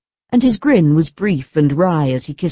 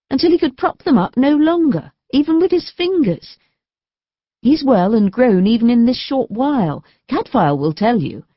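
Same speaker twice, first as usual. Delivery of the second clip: put-on young wife, much higher, delighted; cheerful young wife; 7 semitones higher than usual. A narrator's reading. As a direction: tell the husband happy news of the baby. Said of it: "said Aline cheerfully".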